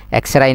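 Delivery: এক্সরে ending in "rai", "ek-se-rai"; mistaken X-RAY. Shown as a demonstration. Teacher showing another mistake, এক্সরে as "ek-se-rai". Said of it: "অথবা এক্সরে ন হইবেন".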